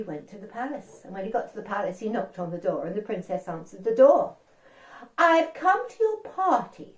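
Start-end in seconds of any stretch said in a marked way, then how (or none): 5.2-6.9 polite frog. Acted. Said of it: "said Frog very politely".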